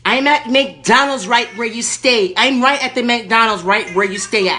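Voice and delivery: high, loud irate lady caller; Erkenbacher. Acted. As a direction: play the furious entitled lady on the phone. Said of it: "Erkenbacher calling back".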